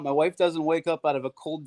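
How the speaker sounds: ordinary talk, nothing marked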